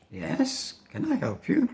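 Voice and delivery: high-pitched, lilting offer of help; course book dialogue. Shown as a demonstration. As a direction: answer softly like a textbook helper, tune exaggerated. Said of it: "course book dialogues".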